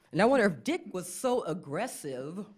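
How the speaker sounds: ordinary talk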